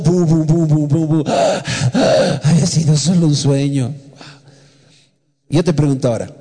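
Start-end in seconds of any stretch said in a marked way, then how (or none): 0.0-1.2 heartbeat imitation. 1.3-2.4 short breathy gasps of someone waking from a nightmare; startled panting on waking; mock waking gasps. Waking up gasping from dream.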